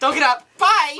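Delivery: high, quick dismissal; curt brush-off. Retold or imitated. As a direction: shoo him off brightly. Voicing Kringle's brush-off.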